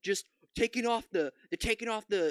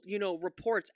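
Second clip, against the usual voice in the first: ordinary talk, nothing marked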